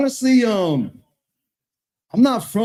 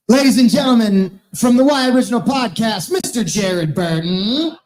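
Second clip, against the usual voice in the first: announcer intro; 4 dB louder than usual. Acted. Host introduces next roaster.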